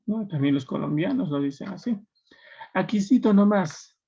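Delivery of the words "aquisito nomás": "Aquisito nomás" a bit higher and louder in an Andean lilt; a light Ayacucho imitation; Ayacucho speech. Shown as a demonstration. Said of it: "los de Ayacucho".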